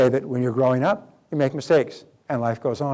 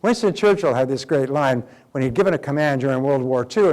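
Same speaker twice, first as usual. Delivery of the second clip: ordinary talk, nothing marked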